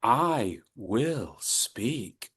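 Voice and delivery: very slow and soft. Shown as a demonstration. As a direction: stretch I will speak slowly and softly. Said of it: "let's do this together".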